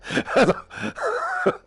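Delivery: real high-pitched laugh. Real, not acted. Gamer laughing at their own bad accent, high laugh. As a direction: burst out laughing at yourself.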